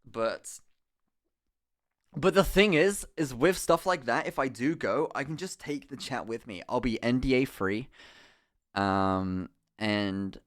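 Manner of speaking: ordinary talk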